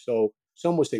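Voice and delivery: ordinary talk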